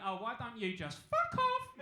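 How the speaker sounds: slow, loud comeback; a hammed-up fed-up retort; mock retort. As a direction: snap it back slowly and loudly. Retorting to the heckler.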